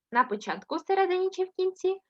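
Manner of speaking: usual speaking voice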